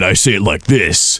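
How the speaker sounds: low tough-cook voice; line cook voice. Shown as a demonstration. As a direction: drop into the rough kitchen guy. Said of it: "that's your line cook voice".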